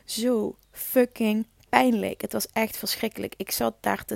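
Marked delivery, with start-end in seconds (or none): 0.0-2.2 very slow, weighted; slowly drawn-out zo fucking pijnlijk. Real, not acted. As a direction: stretch every word of zo fucking pijnlijk. Describing unbearable labour pain.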